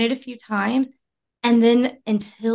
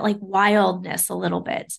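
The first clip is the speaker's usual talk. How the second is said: ordinary talk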